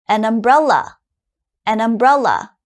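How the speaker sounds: "an umbrella" with the n linked onto umbrella; linked as one word. Shown as a demonstration. Teacher modelling the difference for listening students, linked. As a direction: run "An umbrella" together smoothly. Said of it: "Could you hear the difference?".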